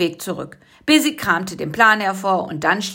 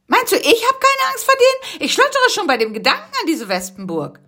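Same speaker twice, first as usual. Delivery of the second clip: little creature voice, much higher and fast-paced; high squeaky Busy. Acted. Busy answers Karl-Heinz, high little creature voice. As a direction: pitch it up like a small excitable bug.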